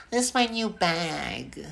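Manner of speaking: hammed-up spoiled rich girl showing off a Chanel bag.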